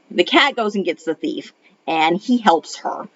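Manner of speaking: ordinary talk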